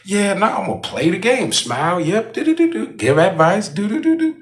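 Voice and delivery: high, sing-song play-along; mock compliance. What they expect of him, pitched high and sing-song. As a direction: hum along like a happy pushover. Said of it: "that I'm just gon".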